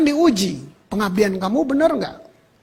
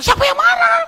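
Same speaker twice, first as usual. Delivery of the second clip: much higher, louder defensive snap with a laugh; mock angry denial; 4 dB louder than usual. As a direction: play the husband shouting he's not angry. Husband denies anger, mocked. (suami menyangkal sambil membentak)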